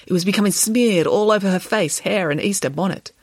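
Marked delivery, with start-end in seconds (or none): none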